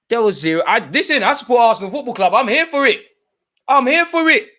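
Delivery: high, loud hyped cry; rising excitement, shout it. Real, not acted. Arsenal fan cheering the penalty to his chat, high loud hype.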